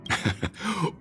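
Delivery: short amused giggle; a quick bright laugh; light laugh. Acted. Cheerful chat about vacation.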